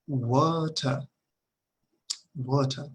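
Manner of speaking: water ending in an open a sound; British water, no r at the end; water with a final a like a Spanish A; British pronunciation. Shown as a demonstration. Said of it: "se pronuncia como si fuera una A".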